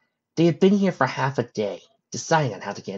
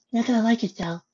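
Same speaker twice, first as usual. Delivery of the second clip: Fluttershy; 11 semitones higher than usual. Acted. Fluttershy telling friends she knows the way, high voice. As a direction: keep it light and high.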